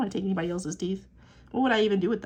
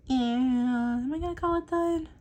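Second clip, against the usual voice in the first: slow musing; 6 semitones higher, 3 dB louder, slower than usual. Real, not acted. Musing over finishing drawing.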